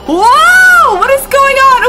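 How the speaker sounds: high, loud startled whoa; shocked outcry. Real, not acted. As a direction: thrown by the glitch. Screen glitch shocks him.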